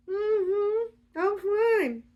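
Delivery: high, squeaky cover-up; hammed-up strained cheerfulness; flustered denial. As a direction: squeak it out, pretending all is fine. Flustered [name] pretends she is fine.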